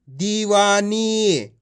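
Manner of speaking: दीवानी very slow, every long vowel held; drawn-out reading. Shown as a demonstration. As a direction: stretch out दीवानी syllable by syllable. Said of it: "खींच खींच के पढ़ना है".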